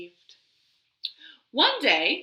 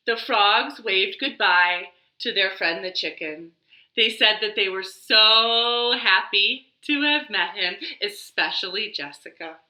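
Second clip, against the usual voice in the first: plain reading voice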